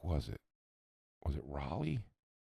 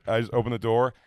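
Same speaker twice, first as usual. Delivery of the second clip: ordinary talk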